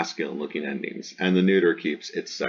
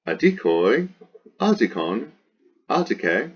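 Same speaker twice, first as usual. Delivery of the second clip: high singsong chant of word forms, each swinging up; paradigm recitation; 6 semitones higher than usual. Shown as a demonstration. Teacher reciting the declension, high singsong drill. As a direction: drill each form separately with an exaggerated pitch accent.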